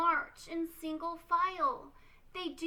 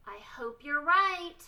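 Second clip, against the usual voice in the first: sighing; 7 dB louder than usual. Acted. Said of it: "sighed Mister Lowe".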